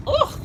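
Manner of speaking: high, sudden groan of disgust; short put-off "ugh". Retold or imitated. Diner whose appetite is lost, disgusted. Reacts to the spoiled mood.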